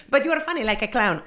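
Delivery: a hint of broken English, a bit high; lightly accented foreigner. Comic quoting the foreign fan, lightly accented. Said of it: "And then he goes".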